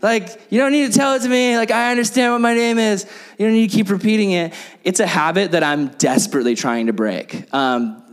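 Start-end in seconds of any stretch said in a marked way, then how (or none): 0.0-4.5 mock God getting tired of his name; exasperated God voice. God answering the repetitive pray-er, higher and exasperated. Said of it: "and God's just like".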